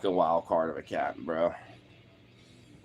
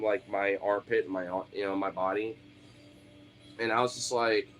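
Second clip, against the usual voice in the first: usual speaking voice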